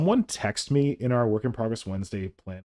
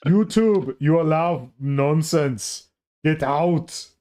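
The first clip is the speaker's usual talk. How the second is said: put-on German accent; 2 semitones higher, much slower than usual. Streamer voicing an angry German viewer, in a German accent. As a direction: growl it like Arnold Schwarzenegger in German accent. Imagined German complaint, Arnold impression.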